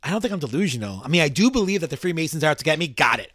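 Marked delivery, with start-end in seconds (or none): none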